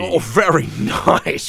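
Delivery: put-on lightly done, mediocre Scottish Connery accent; a rough attempt at Connery's Scottish accent; Sean Connery accent. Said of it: "this is my bad Sean Connery accent".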